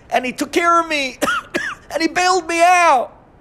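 high and loud, swept up in the plea; sustained high-pitched fervent pleading; impassioned preaching. Acted. [name] voicing the people.

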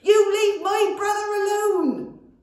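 very high, loud shouting charge; battle cry. A narrator's reading. Goblin charges the dragon, yelling. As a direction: yell it as you charge.

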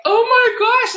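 high, loud mock alarm; dismayed outcry. Guest retelling her birthday shock to the host, mock dismay. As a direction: re-enact a horrified realization.

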